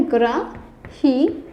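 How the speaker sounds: ग्रहित stretched out slowly and clearly; slow correct pronunciation. Shown as a demonstration. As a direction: draw out ग्रहित for the class to repeat. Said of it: "ग्रहित होता है".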